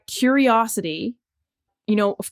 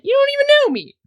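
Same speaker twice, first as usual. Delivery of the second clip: much higher, louder playful protest; a light hammed-up huff; mock indignant; 5 dB louder, faster than usual. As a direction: squeak it out like a put-upon friend. Jokey aside to an imagined Janet.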